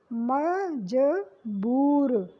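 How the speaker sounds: मजबूर spelled out very slowly, syllable by syllable; sounding out syllables. Shown as a demonstration. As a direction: stretch मज-बूर, long ऊ. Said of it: "शब्द क्या होता है?". (मजबूर — बहुत धीरे, अक्षर-अक्षर)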